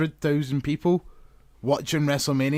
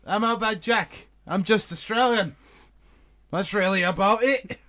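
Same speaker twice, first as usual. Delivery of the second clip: higher-pitched bad Aussie wrestler, sounding English; botched Australian accent in a raised voice; failed Australian accent; 7 semitones higher than usual. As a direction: attempt Australian and land on English. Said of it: "That was an English accent".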